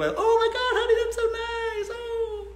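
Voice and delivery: a mock mom shrieking with delight; gushing mom. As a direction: play the thrilled mother hearing her daughter met a doctor. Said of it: "she's gonna go".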